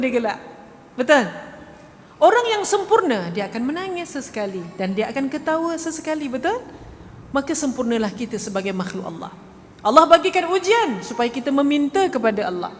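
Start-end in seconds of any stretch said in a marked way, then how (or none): none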